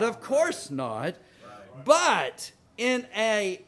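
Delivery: high, loud, impassioned sermon climax; preaching at full force. Acted. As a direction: thunder it out to the congregation.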